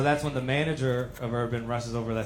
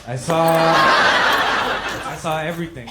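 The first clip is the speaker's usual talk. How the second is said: much louder, slow insistence; comic insisting witness, loud and slow; emphatic; 4 semitones higher, 10 dB louder, much slower than usual. Real, not acted. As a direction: drive it home.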